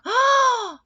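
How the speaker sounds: sharp high gasp of alarm; big startled gasp; loud gasp. A narrator's reading.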